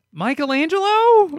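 high, loud questioning yelp; loud, high-pitched outraged question; incredulous shout. Pretend. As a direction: yell the name in mock disbelief. Mocking the weapon crossover decision.

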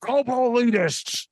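high raspy ranting host; Alex Jones voice. Acted. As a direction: bark it like a radio host. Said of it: "gonna hurt your voice".

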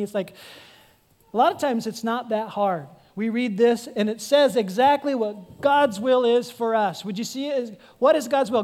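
ordinary delivery

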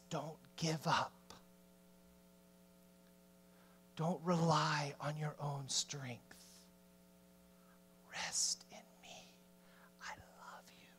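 low, hushed reassurance, falling to a bare whisper; God's comforting whisper. Acted. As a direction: whisper tenderly, as God to his child. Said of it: "He whispers because he's close".

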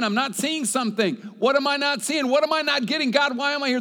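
rising desperate cry to God, climbing high and loud; anguished prayer. Acted. Preacher voicing a frustrated believer's prayer, pleading loudly.

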